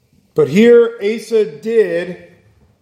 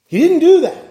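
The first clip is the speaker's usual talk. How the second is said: higher and louder, hammering it home; emphatic; 10 semitones higher, 5 dB louder than usual. Real, not acted. Preacher insisting to the congregation, emphatic and loud. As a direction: slam the point down hard. Preacher stresses Asa's refusal.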